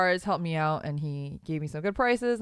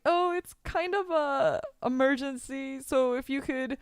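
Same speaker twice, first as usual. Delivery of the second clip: whiny, pleading retold plea; mock pleading; 6 semitones higher, slower than usual. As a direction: beg the office half-jokingly with a crack in the voice. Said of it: "And I was like".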